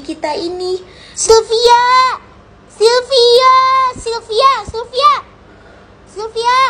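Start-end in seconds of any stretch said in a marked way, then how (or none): 1.1-6.6 loud, high call across the house; impatient boss hollering for Sylvia. Acted. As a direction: yell her name from another room. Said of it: "Nggak perlu aku teriak-teriak".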